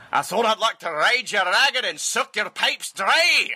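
high, slow Scottish husband; hammed-up Scottish old husband delivering a bawdy line; Scottish accent. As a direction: draw it out in a put-on Scottish voice. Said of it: "he does it in a Scottish accent".

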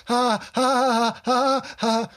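high, insistent forced ha-has; two kids trying to out-laugh each other, mimicked; competing fake laugh. Dad imitating kids competing with each other, forced laugh. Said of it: "and getting louder, trying to compete".

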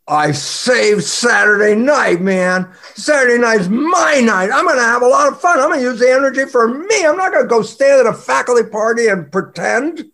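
loud, high-pitched jubilant outburst; energized triumph. Acted. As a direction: celebrate your freed-up night at full voice. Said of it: "bolt of energy".

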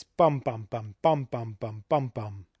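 BUM bum bum, BUM bum bum, BUM bum; metrical stress. Shown as a demonstration. Lecturer demonstrating a whole line's rhythm, rhythmic stressed chant. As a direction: beat out "bum" in threes, ending on a falling pair. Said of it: "stressed syllable and one unstressed".

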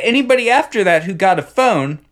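usual speaking voice

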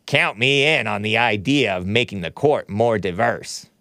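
mock senator voice, put-on and prim; a put-on Lindsey Graham, prim and fawning; Lindsey Graham impression. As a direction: lampoon a kissing-up senator. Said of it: "That's my impression of Senator Lindsey Graham".

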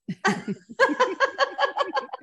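long, high-pitched laugh; helpless high laughter; high giggle. Real, not acted. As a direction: burst out giggling at your own story. Laughing at her own story.

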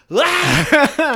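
a sudden high, loud cry of pain at a bad accent; pained yelp. A film fan hearing a bad accent on screen, pained high yelp. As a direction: yelp like the accent physically hurts. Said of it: "you're like".

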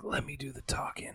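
hushed, breathy cop aside; whisper. Retold or imitated. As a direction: whisper it conspiratorially. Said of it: "and he's like".

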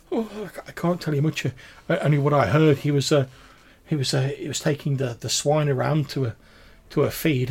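hopeful but tearful wife, words tripping; distraught village woman. Acted. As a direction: falter through fragile hope.

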